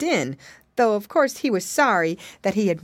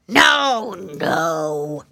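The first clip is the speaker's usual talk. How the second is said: loud first word, then dropping; snapped refusal; 5 dB louder than usual. A narrator's reading. Alligator refusing captives' plea, loud villainous snap. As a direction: refuse as a mean storybook alligator. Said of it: "snapped the alligator".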